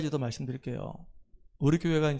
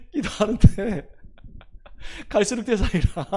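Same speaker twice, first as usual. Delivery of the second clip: louder start, words breaking into laughter; laughing while telling; 4 semitones higher, 3 dB louder than usual. Real, not acted. Pastor on praying for the contractor, laughing as he talks. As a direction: tell it through real laughter.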